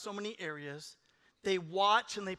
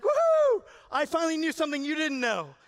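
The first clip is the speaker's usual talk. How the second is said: much higher, louder cry of triumph; gloating excitement; 7 semitones higher, 8 dB louder than usual. Retold or imitated. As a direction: crow it like you finally won. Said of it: "You're, like, all excited".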